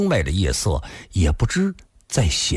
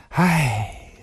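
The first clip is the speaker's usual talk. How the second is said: long acted sigh, pitched up; 5 semitones higher than usual. A narrator's reading. Woman sighing to her partner about his friend, regretful sigh. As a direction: let out a drawn-out womanly sigh. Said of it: "林仙儿又叹了口气".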